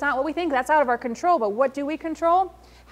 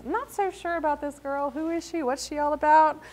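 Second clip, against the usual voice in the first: wary onlooker thoughts; 4 semitones higher than usual. Shown as a demonstration.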